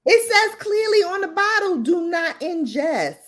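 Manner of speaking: high, slowing to the warning; high-pitched exasperated insistence; indignant emphasis. Real, not acted. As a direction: spell out the warning in disbelief. Indignant quoting of bottle warning.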